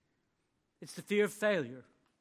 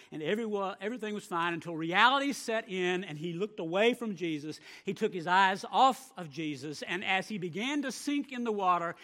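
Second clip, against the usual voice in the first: ordinary talk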